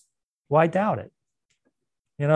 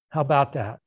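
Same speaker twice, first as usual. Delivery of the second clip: ordinary talk, nothing marked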